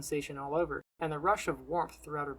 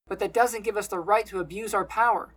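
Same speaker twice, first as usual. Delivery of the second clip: high, loud principled protest; insistent rebuttal; 4 semitones higher, 7 dB louder than usual. A narrator's reading. Selden reminds his friend of responsibility, loud rebuttal. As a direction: argue back urgently, raised voice. Selden argues back in quarrel.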